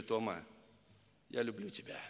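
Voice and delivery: soft, low words to his wife; husband whispering love to his wife; tender confession. Retold or imitated. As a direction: say it gently, close to her.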